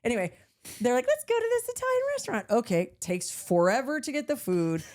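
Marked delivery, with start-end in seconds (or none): 1.1-2.5 high, fast chirpy suggestion; cheery mock friend. Friends urge a restaurant, high fast chirpy voice. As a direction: mimic friends breezily pushing a plan.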